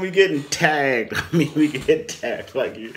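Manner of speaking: ordinary talk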